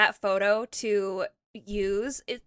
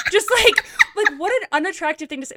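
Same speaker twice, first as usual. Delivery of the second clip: real laughter breaking into the words; laughing; 6 semitones higher, 6 dB louder, faster than usual. Real, not acted. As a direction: talk through amused disbelief, laughing. Laughing at the date's remark.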